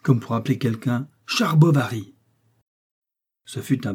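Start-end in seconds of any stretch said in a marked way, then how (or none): 1.2-2.1 louder and higher, calling out the name; full-lunged call. A narrator's reading. New boy blurts his name at full lungs. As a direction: bellow it as if calling someone across a field. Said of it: "lança à plein poumon".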